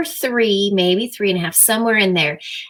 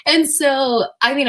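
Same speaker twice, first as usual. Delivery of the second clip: normal talking voice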